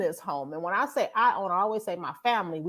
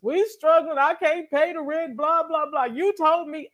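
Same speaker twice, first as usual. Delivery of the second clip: higher, whiny prayer imitating herself; mock crying prayer; 6 semitones higher than usual. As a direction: complain to God in a mock plaintive whine. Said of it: "I'm still crying".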